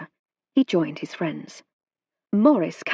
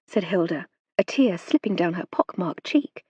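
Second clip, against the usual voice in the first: ordinary delivery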